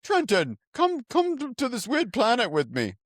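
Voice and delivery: mock Jar Jar enthusiasm. Host teases cohost about Jar Jar Binks, high and giddy. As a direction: push the pitch way up to needle your friend.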